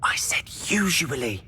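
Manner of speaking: hushed, breathy whisper; whispered urgently. Acted. Sneaking near the librarian.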